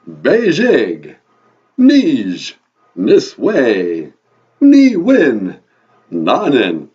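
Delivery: put-on loud Ojibwe counting in a Transylvanian vampire accent. Count repeats the host's Ojibwe numbers, vampire accent. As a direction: proclaim each number in a booming vampire accent. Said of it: "your vampire accent".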